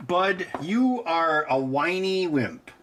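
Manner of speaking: slow, drawn-out teasing to the cat; slow teasing sing-song. Pretend. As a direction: stretch every word out to tease the kitten.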